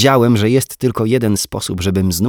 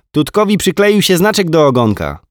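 put-on high, chirpy little boy; small boy reporting his cartoon to his mum; child voice; 9 semitones higher, 8 dB louder than usual. A narrator's reading. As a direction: tell it like a proud small kid. Small boy telling cartoon story.

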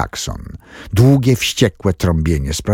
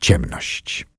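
whisper; 6 semitones lower, 6 dB quieter than usual. A narrator's reading. Narrator ends the crash scene.

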